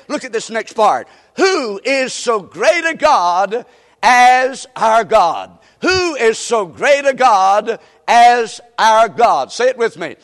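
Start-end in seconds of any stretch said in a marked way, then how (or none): 1.4-9.5 loud, very slow pulpit cry; scripture proclamation. Real, not acted. Preacher proclaims God's greatness to the congregation, loud and slow. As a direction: draw out each word at full force. Proclaims key verse at full force.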